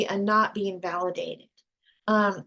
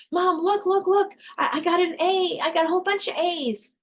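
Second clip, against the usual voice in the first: put-on excited little kid; 8 semitones higher than usual. Child showing mom the report card, high and excited. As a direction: bounce it up like a proud kid.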